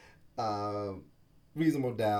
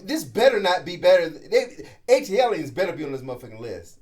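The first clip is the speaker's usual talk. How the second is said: emphatic loud demand; 5 semitones higher, 10 dB louder than usual. Real, not acted. Insists the album better be listed.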